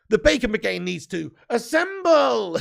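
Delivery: loud, drawn-out mock battle call; rallying cry. Streamer rallies viewers to hit like, rallying cry.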